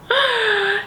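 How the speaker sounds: sharp high delighted gasp. Real, not acted. Perfume fan gasping at a favorite character. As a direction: gasp with sudden excitement. Gasps thinking of Keely.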